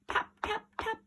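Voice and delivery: plain reading voice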